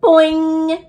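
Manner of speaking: high sprung boing; imitated boing. Girl showing the class how the frog sounds, high twang. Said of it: "He goes".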